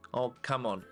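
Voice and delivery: usual speaking voice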